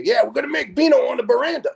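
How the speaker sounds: high, fast playful voice; mock Larry. Larry announcing wine plans to the boss, high quick voice. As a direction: chirp it like an eager conspirator. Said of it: "would say".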